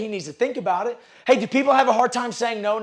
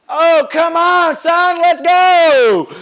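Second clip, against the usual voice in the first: scoffing shout; 8 semitones higher, 12 dB louder, much slower than usual. Retold or imitated. Salesman scoffing at easy objection.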